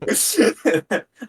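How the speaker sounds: bright falling laugh; high laugh. Real, not acted. Laughing at the professor story. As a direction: laugh at the memory.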